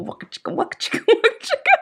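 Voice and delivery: put-on mouthed old-school waka-chicka riff; half-whispered wah-wah music imitation; mock adult-film music. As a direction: imitate a funky guitar vamp, breaking into laughter. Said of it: "adult film music".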